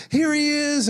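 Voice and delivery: high, sing-out cheer; excited announcement. Acted. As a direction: herald the groom's arrival in a high voice. Acts crowd cheering groom's arrival.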